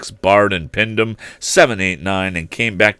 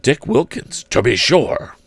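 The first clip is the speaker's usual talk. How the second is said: delighted; 6 semitones higher than usual. Acted. Scrooge recognises old friend.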